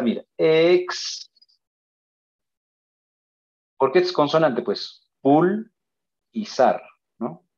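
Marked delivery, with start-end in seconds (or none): 0.4-1.3 Ex held long and high; silabeo. Shown as a demonstration. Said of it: "Ahora tú lo vas a intentar". 5.2-6.8 Pul raised, then zar, set apart; silabeo. Shown as a demonstration. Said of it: "Ahora tú lo vas a intentar".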